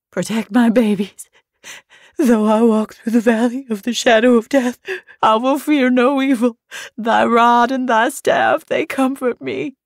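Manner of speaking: high, loud desperate psalm; cling to the psalm in terror; frantic weeping prayer. A narrator's reading. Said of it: "Daphne was weeping now, desperate, frantic".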